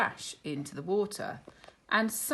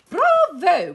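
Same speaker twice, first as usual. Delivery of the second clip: Miss Cluck voice; 13 semitones higher, 15 dB louder than usual. Acted. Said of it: "agreed Miss Cluck".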